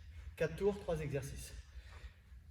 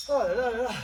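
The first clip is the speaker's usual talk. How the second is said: exasperated exclamation; 7 semitones higher, 9 dB louder than usual. Real, not acted. Exhausted after the workout round.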